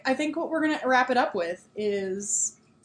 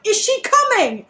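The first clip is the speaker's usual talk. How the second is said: higher, slow alarmed Harry; Harry asking Dobby, higher and slow; tense question; 11 semitones higher, 6 dB louder, slower than usual. Acted. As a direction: ask it slowly, dreading the answer. Harry asks in panic.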